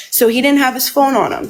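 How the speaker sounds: normal talking voice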